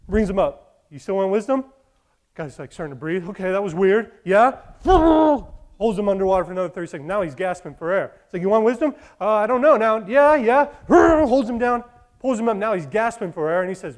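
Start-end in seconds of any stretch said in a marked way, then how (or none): 0.9-4.6 loud retold exchange; hammed-up dialogue; lightly voiced wise man and kid. 4.6-5.8 loud hammed-up throat clear; a comic pause before the dunk; hammed-up throat sound; mock throat-clearing shove. Mock dunking in the story. 8.4-10.7 higher, louder hammed-up voices; hammed-up wise man and kid; loud mock exchange. 10.7-11.2 loud hammed-up throat clear; a comic pause before the dunk; hammed-up throat sound; mock throat-clearing shove. Mock dunking in the story.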